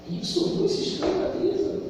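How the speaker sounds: hushed repeat. Acted. Repeats question hushed, unvoiced.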